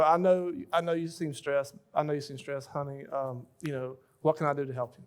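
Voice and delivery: soft, comforting reassurance to a stressed husband; tender comfort. Retold or imitated. Wife comforting stressed husband, soft and tender. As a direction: soothe him softly, honey. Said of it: "the way you respond emotion to emotion".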